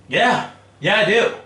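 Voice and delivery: cocky comeback, high and loud; defiant confirmation. Retold or imitated. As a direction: throw it back at the boss triumphantly. Retold defiant answer to boss.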